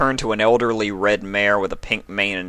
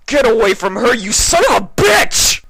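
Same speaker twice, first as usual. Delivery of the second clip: shouting; 8 dB louder than usual. A narrator's reading. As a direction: scream at him to protect her. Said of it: "Spike shouted".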